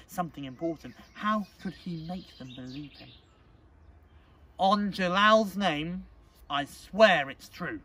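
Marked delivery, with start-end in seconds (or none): 4.6-7.8 slow, loud, solemn oath; earnest insistence. Acted. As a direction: swear it slowly and forcefully. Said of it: "he insisted".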